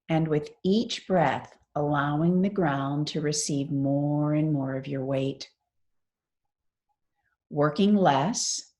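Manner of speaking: measured meditation-teacher delivery with long pauses; guided meditation. Real, not acted. Teacher guiding a class lying on the floor through mindful movement, guided meditation. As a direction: lead them through it phrase by phrase, unhurried.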